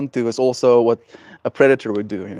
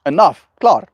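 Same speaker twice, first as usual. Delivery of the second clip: decisive cutoff; 7 semitones higher, 5 dB louder than usual. Retold or imitated. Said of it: "I'll be like".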